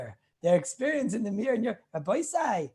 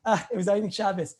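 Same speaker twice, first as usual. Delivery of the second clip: ordinary talk, nothing marked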